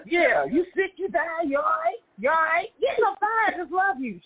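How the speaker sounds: high, slow fussing questions; mock worried sibling. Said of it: "acted concern for brother".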